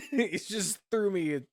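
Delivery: high, loud laugh running into words; real laughter mid-story. Real, not acted. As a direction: laugh through the line. Laughing at the memory.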